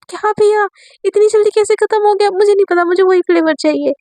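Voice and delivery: put-on spoiled girl upset her flavor ran out; whiny complaint. Amaira complains to the vendor about her flavor, whiny. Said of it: "बहुत ज़्यादा गुस्सा आता है".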